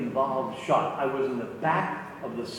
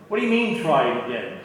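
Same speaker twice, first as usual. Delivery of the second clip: angry retort; 6 dB louder than usual. Retold or imitated. Actor snapping at the director, loud and indignant. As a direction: bite back at being told to go again. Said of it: "he was getting mad".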